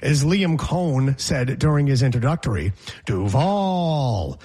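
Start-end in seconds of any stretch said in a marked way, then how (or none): 3.1-4.4 mock Duval with the VAL held long; mispronounced Duval. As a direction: imitate a drawn-out Duval, second syllable stretched. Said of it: "which is not how you say Duval".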